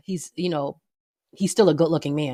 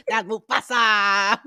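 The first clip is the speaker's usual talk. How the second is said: like [name]; 5 semitones higher, much slower than usual. Retold or imitated. Naming the voice of Mufasa in a [name] yell. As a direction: stretch it out like [name] screaming. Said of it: "I'm saying it like [name]".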